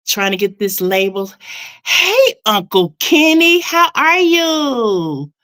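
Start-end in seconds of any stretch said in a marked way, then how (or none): 1.9-5.3 high, loud, delighted hello with a slow "how are you"; light up for a favourite uncle; happy greeting. Real, not acted. Greeting uncle joining the stream.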